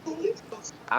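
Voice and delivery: high laugh. Real, not acted. Laughs at crouch talk.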